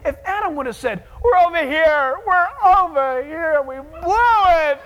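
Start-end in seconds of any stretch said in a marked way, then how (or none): 1.2-4.8 calling confession. Acted. Adam calls out to God from the garden, loud confession. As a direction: wave your arms and own it.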